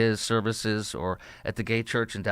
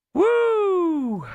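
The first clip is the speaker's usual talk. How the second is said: whoop; 3 dB louder than usual. Real, not acted. Sarcastic whoop after quoting opponent.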